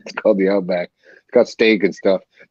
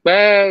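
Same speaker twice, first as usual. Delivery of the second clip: a high mock goat bleat; 10 semitones higher, 4 dB louder than usual. Retelling a Komodo video, mimicking the goat's high bleat. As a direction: bleat it like a goat being eaten alive. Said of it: "And the goat's like".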